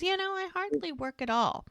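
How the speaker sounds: high-pitched, airy put-down; mock dismissive. Man at the bar putting down another's job, dismissive. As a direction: play down the job with a high lilt. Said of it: "putting down what he does".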